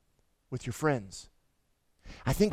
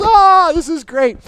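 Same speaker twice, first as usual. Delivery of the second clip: loud, high, slow delighted shout; thrilled yell; 12 semitones higher, 14 dB louder, slower than usual. Retold or imitated. Greg answering the pastor while speeding, thrilled yell. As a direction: shout with glee over the wind.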